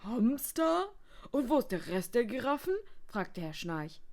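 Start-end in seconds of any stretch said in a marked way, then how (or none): 0.0-2.8 high, loud, incredulous asking; baffled question. A narrator's reading. Guest questioning the receptionist, baffled. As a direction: ask it in loud disbelief.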